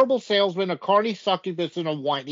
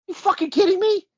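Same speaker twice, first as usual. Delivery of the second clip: fast, much higher, in disbelief; incredulous outburst; 12 semitones higher, faster than usual. Retold or imitated. Seth backstage hearing the deal, incredulous fast high outburst. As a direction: fire it off high and quick, like you can't believe it. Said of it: "And Seth backstage says".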